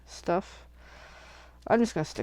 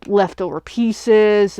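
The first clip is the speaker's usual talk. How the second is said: ordinary talk, nothing marked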